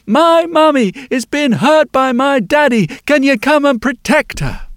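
put-on a small girl begging for help; pleading little girl. Girl begging blacksmith to protect mummy, high urgent plea.

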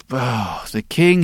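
plain reading voice

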